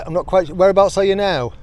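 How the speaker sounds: usual speaking voice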